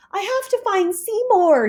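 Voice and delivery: high urgent voice; worried Splat. Acted. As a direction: hurry it out in worry.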